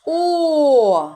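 О sustained loud and high; isolated vowel. Shown as a demonstration. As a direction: hold the О for the children. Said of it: "Яка літера?".

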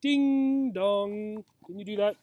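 plain reading voice

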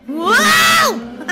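high, loud whoa; excited shout. Real, not acted. Gamer hits a speed boost, excited shout. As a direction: let out a big thrilled shout.

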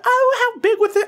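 very high falsetto mock mom; imitating mom. Mom asking about the spider, high falsetto imitation. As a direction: pipe it up high as an unbothered mother. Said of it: "She's like".

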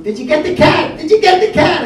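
high, loud mock shriek, building on the second "cat"; imitated shrieking woman. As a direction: screech it like the hysterical lady at the fire. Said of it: "the lady was screaming".

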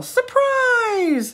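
high, loud party shout; surprise yell. Acted. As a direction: jump out and shout it. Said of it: "His friends yell".

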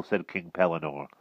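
plain reading voice

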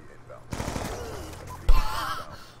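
squeaky disbelieving laugh, way up high; high startled laugh. Real, not acted. Startled high laugh. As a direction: laugh in shock at the teleporting enemy.

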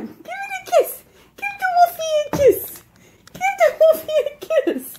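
very high, squeaky dog-talk; high-pitched pet voice; coaxing pet talk. Real, not acted. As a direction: urge the dog on in a high, playful voice.

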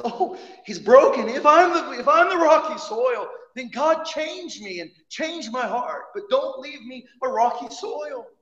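a humble believer begging God to change him; pleading believer. Retold or imitated. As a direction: beg God to change your heart. Believer voiced praying for change.

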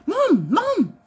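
high, insistent calling; needy husband. Retold or imitated. Wife imitates husband calling for her, high insistent. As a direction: nag Mom for attention.

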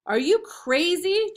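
worried high call. A narrator's reading. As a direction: call out after her in alarm. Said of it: "Jack called".

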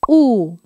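"U" with lips pushed out and rounded; exaggerated rounded Spanish "U". Shown as a demonstration. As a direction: pout the lips forward for "U". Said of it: "muy redonda".

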